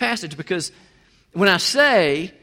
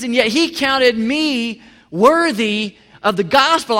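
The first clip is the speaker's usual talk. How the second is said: higher, louder, slow amazement; astonished confession; 5 semitones higher, 6 dB louder, slower than usual. Retold or imitated. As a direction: say it stunned by grace. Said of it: "He's saying".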